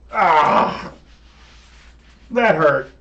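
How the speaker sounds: loud, high cry of pain, then a complaint; pained yell turning into a grumble; hurt demon. Acted. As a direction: play a villain stung by the blow. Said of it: "And he goes".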